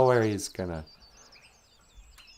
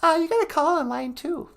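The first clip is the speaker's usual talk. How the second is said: very high squeaky puppet wife greeting. Acted. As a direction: chirp it in a tiny high voice.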